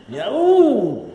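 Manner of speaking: loud, high yelp of pain; demo yell of pain. Shown as a demonstration. Lecturer shows how he yelled after hitting his thumb, loud yelp. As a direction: let out a sudden cry as if the thumb is hit. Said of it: "also ich schreie, ich brülle".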